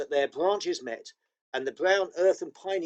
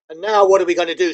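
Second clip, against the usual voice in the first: loud, fast, annoyed demand; exasperated; 9 dB louder, faster than usual. Acted. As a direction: snap the question at the others. Edmund complaining, lost.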